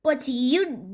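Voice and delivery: ordinary delivery, nothing marked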